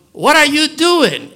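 loud, high-pitched acted challenge; confronting. Samuel asking Saul to account for himself, loud confronting challenge. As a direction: demand an answer, voice jumping up.

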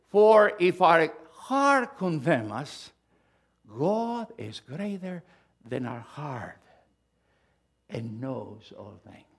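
slow, drawn-out scripture voice; solemn scripture reading. Acted. Pastor reading John's letter slowly to the congregation. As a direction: read each word slowly and weightily.

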